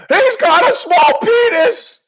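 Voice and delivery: high, loud, drawn-out shriek; mock-hysterical high yell. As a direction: shout it so the next room hears. She yells from bathroom, couple hears.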